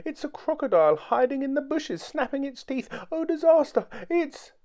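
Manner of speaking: very high squealing hysteria; terrified shriek. A narrator's reading. Mister Jelly imagines falling trees and crocodiles, shrieking. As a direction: squeal one disaster after another in panic. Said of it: "he shrieked".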